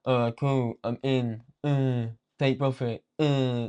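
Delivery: slow, vacant, spaced-out voice; mock brain-dead calm; brain-dead robot trader. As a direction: drone it out like you're half asleep. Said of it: "brain dead as fuck".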